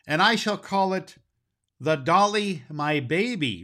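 put-on high, light little girl's voice, tender over her doll; a girl naming her new doll; a lightly voiced young girl, fond. Bessie speaking to her dolly.

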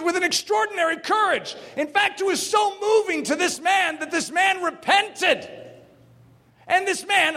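high, shouted preaching; preaching at full force. Acted. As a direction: preach it with fire, near shouting. Preaching climax on James's courage.

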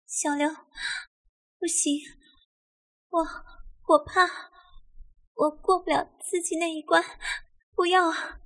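high, halting, breath-broken plea; trembling frightened woman. A narrator's reading. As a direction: plead fearfully with pauses between phrases. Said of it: "颤抖地推着刘峰高的身体说".

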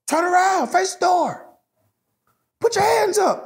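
high, strained police orders at the door; a cop yelling turn around, hands up; barking cop commands. Retold or imitated. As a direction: bark it like a tense officer.

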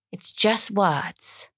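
very slowly spelled out; slow it right down to soothe; slow reassurance. Shown as a demonstration. Soothing herself about the book.

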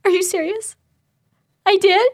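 a hint of a trembling thirteen-year-old; tremory voice. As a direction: imitate a shaky, stunned kid on the phone. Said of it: "Hilly's voice is all tremory".

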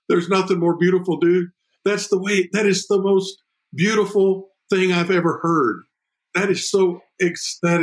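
host gushing in admiration; moved excitement. Real, not acted. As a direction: sound blown away by the story.

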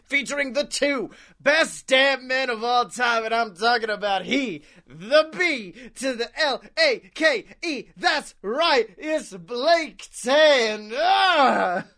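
booming circus announcer intro; ringmaster. Pretend. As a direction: hype up the crowd like a ringmaster. Podcast intro, announcer hype.

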